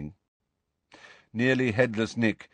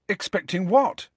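put-on higher, curious young voice; a boy asking sharply; 10 semitones higher than usual. A narrator's reading. Harry voiced.

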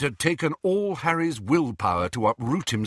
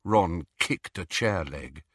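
ordinary delivery

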